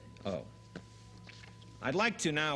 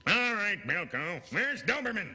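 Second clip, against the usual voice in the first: commanding officer; 7 semitones higher, 4 dB louder than usual. Acted. Sketch, gruff commanding officer.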